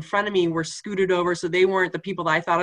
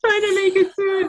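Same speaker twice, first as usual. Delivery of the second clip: laughing; 12 semitones higher, 3 dB louder than usual. Real, not acted. Laughs recalling getting through finale.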